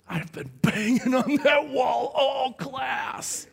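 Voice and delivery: high, drawn-out mock stage shout; classmate's confession. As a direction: act out a kid confessing with drawn-out glee. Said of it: "he leans over to me and goes".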